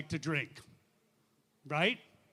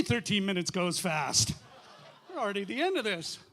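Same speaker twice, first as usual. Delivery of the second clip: ordinary delivery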